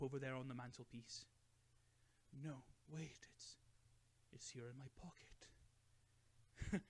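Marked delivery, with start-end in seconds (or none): 2.4-6.8 slow, hushed whisper; secretive whisper. A narrator's reading. Bilbo admitting to Gandalf the ring is in his pocket, whispered. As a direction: confide it under the breath.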